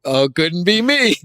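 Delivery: high-pitched mock reaction-video voice; reaction guy. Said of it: "And they go".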